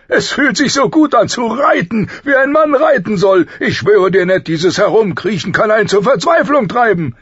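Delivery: high, booming, elated royal voice; exuberant king. A narrator's reading. King relishing the ride with his friend, exuberant and loud. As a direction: let it ring out with rough joy.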